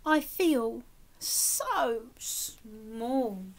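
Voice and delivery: slow, soft little snail voice; whisper-small, drawn out and humble; tiny snail voice. Acted. The snail telling the whale she feels tiny, slow soft small voice. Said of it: "and she said to the whale".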